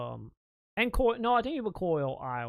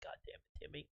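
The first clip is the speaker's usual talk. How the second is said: exasperated whisper; 22 dB quieter, faster than usual. Retold or imitated. Exasperated aside about Timmy.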